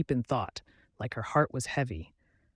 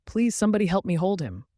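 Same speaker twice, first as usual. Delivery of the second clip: desperate call; 6 semitones higher, 4 dB louder, faster than usual. A narrator's reading.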